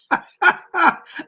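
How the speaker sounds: a high, bursting laugh at a funny comment; bright laugh. Real, not acted.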